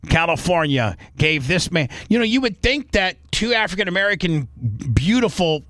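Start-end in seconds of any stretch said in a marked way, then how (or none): none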